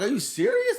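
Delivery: high squeaky disbelief; incredulous. Retold or imitated. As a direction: act shocked, voice jumping up. Retold disbelief at being misheard.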